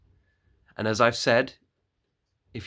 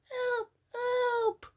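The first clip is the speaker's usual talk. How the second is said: very high, faint distant screams; far-off cries for help. Acted. Woodcutter hears screams from the cottage, high faint distant cries. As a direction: cry out as if from far away.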